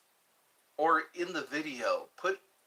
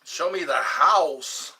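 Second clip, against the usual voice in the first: slow loud put-on voice; 3 semitones higher, 10 dB louder, much slower than usual. Put-on voice demand.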